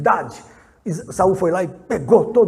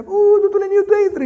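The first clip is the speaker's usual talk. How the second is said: much higher, sing-song greeting; high sweet old lady; 9 dB louder than usual. Retold or imitated. Old neighbor calling the pastor in, high and sweet.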